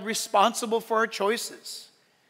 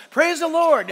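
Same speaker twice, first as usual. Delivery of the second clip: higher, louder praise; playful raised stone voice; 6 semitones higher, 6 dB louder than usual. Pretend. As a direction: play a smaller stone calling out. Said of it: "There's some, like, stones".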